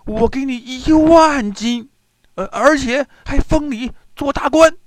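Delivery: high, loud, anxious bargaining; hurried urgent pleading. A narrator's reading. Captured prince bribing a fisherman to save him, urgent high pleading. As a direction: plead urgently, stumbling into bigger offers. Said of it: "兀竹听后连忙说".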